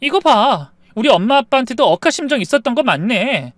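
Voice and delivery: high, loud accusation. Acted. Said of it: "트집을 잡으며 목소리를 높이는 아내".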